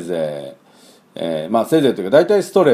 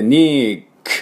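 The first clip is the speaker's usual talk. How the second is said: ニーク with a long stressed "NEE" and bare k; English "-nique" ending in ニーク, voiceless k; 4 semitones higher, much slower than usual. Shown as a demonstration. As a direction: stretch the ニー of ニーク slowly. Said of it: "にのところを強く言えば".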